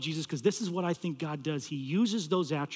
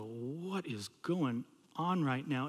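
slow, drawn-out, hushed question; stunned disbelief; 3 semitones lower, 8 dB quieter, much slower than usual. Retold or imitated. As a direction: stretch the words out in quiet bewilderment. Stunned reply to screaming caller.

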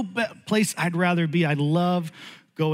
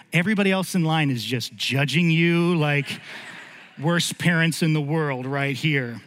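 normal talking voice

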